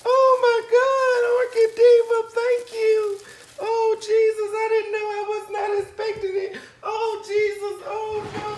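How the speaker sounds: very high, crying with joy; tearful joy. Real, not acted. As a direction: thank them through happy tears. Tearful thanks for gift.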